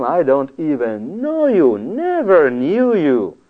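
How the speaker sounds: slow, drawn-out final rejection; God's stern verdict. Retold or imitated. Preacher voices God rejecting false followers, slow and drawn out. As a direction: let each word fall slowly like a judgment.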